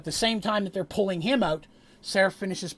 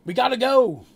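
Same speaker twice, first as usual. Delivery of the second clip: loud, high shout to leave; urgent yell; 7 semitones higher, 9 dB louder than usual. Acted. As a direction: yell to the group to get moving. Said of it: "yells Red".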